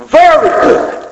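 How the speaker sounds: very good rung out high; exultant cry. Acted. Preacher to congregation, echoing God in a high exultant cry. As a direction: lift it up like a shout of praise.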